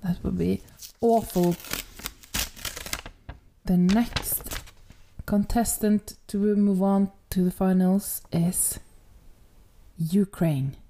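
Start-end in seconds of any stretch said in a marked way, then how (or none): 3.6-10.8 grave, halting winner reveal; subdued contest host, dropping on the name; solemn announcement. Real, not acted. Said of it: "so solemn now".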